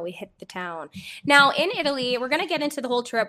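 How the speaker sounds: ordinary delivery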